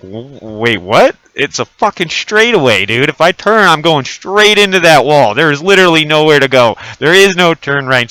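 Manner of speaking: loud, high, incredulous arguing; exasperated re-enacted objection, loud; retold protest. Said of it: "And I'm like".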